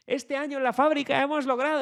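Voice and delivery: hammed-up delighted announcement; exaggerated joy. Shown as a demonstration. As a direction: oversell the good news like a party. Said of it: "ponerte muy alegre. Exagéralo, muy feliz".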